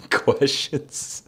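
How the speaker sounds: squeaky high laughter breaking through a word; high giggle. Real, not acted. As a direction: giggle high while reading. Laughing at the question.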